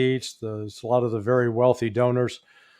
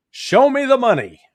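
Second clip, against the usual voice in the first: loud, high movie catchphrase yell; Cuba Gooding Junior; 10 semitones higher, 6 dB louder than usual. Retold or imitated. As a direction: belt out the famous film line, pitched way up. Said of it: "Cuba Gooding Junior, that actor in the Jerry Maguire movie".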